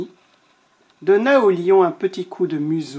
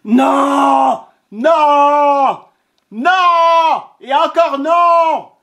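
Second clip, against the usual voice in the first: high, loud shouted nos; roaring lion, fed up, high and loud; furious refusal; 8 semitones higher, 6 dB louder, much slower than usual. Acted. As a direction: hammer every no like a raging lion.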